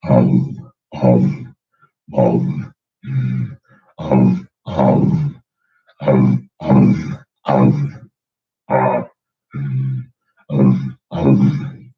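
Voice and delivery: low rumbling ums; bass sounds demo. Shown as a demonstration. Demoing vocalized chest bass, low hums. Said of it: "vocalized chest bass".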